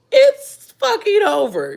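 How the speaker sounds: high, slow, drawn-out hype; excited. Real, not acted. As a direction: stretch every word out, fired up. Hyped about others' success.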